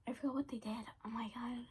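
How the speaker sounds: soft embarrassed whisper. Real, not acted. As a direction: whisper it to yourself. Embarrassed aside.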